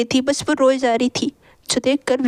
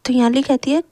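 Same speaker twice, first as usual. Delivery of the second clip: plain reading voice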